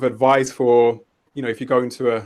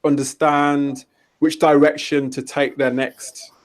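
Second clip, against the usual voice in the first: normal talking voice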